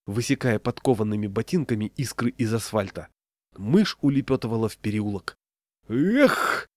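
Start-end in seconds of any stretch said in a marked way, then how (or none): 5.9-6.7 high, effortful cry; shouted exclamation. A narrator's reading. Левандовский hurling his cap at a mouse, shouting. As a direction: cry out with the throw. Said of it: "крикнул Левандовский".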